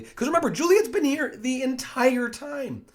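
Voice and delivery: loud, very high burst; emphatic exclamation. Real, not acted. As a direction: belt out the reminder in disbelief. Teacher exclaims over Juliet's presence.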